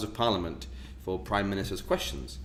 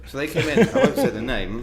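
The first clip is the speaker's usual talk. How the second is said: ordinary delivery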